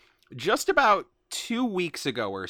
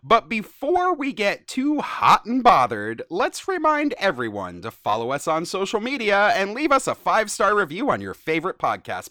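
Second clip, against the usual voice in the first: cheesy host; 6 semitones higher, 4 dB louder than usual. Acted. Reading AI script.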